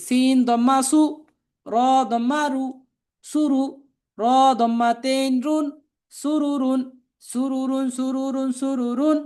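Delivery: spelling drill recitation. Shown as a demonstration. Teacher spelling out Arabic words for learners to repeat, chanted drill.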